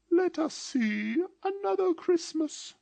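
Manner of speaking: very high, slow, eerie; an otherworldly spirit asking gently; slow high ghost voice; ghostly spirit voice. A narrator's reading. The spirit speaks.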